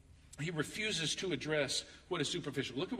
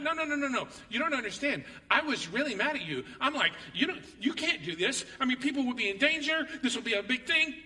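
higher, louder indignant wife imitation; mock outrage; 8 semitones higher, 4 dB louder than usual. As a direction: protest like the upset wife in the story. Acting wife's angry dream.

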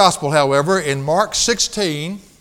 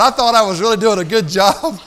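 laughing while talking; 2 semitones higher, 4 dB louder than usual. Real, not acted. Laughing at own story.

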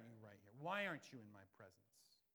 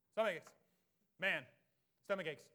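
"Stomach aches" said loud and punchy; 3 semitones higher, 11 dB louder than usual. Shown as a demonstration. Preacher correcting himself, loud and emphatic.

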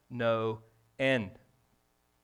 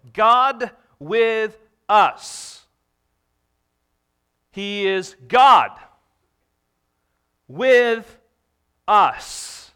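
slow, loud proclamation, each word held apart; proclaiming; 6 semitones higher, 12 dB louder, much slower than usual. Real, not acted. Preacher to congregation: loud, slow proclamation.

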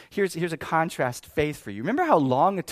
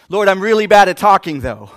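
loud, raised-pitch excuse; protesting Moses; 4 semitones higher, 16 dB louder than usual. Acted. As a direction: plead loudly to get out of it. Moses pleading to be let off.

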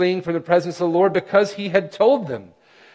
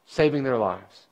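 ordinary talk

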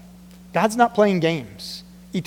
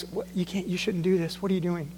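much softer, hushed pleading; gentle plea; 9 dB quieter than usual. Retold or imitated. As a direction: plead softly with a friend. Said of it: "have gone to her and said".

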